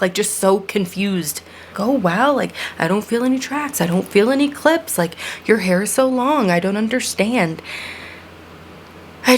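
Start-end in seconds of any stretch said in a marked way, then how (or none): 1.9-7.6 high, wide-eyed imitation of a baffled stranger; mock amazement. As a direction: play the dumbfounded stranger, pitched up. Mimicking people touching her hair.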